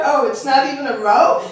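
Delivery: ordinary talk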